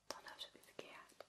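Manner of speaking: ordinary talk, nothing marked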